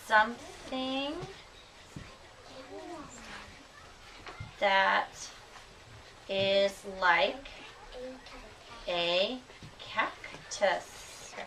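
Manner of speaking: words stretched and spaced out; slow dictation. Shown as a demonstration. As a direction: dictate slowly for the class to follow. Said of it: "teacher dictates while writing".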